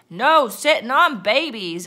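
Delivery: hollered. A narrator's reading. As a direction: holler it as a whole crew. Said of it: "hollered the crew".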